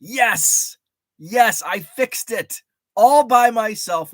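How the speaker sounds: loud, high, slow cry of victory; excited triumph. Real, not acted. Proud of fixing the mower himself, excited and loud. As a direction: savor every word of the win.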